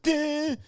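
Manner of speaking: high sung ding like a bell; mock sitcom sound cue; imitated chime. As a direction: ring it out high and bright. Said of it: "the music's like".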